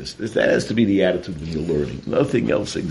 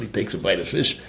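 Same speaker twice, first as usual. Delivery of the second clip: ordinary talk, nothing marked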